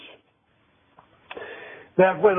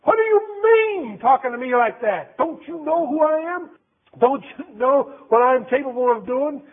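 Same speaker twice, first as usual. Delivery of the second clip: indignant, higher-pitched outburst; offended, high-pitched demand; angry; 7 semitones higher than usual. Acted. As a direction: act out Peter bristling at being challenged. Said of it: "He got angry".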